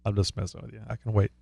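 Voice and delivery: normal talking voice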